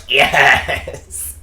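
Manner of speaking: loud, high drawn-out cheer; overjoyed yell. Retold or imitated. Student answering the professor's compliment, loud elated yell. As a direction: cry it out in delight.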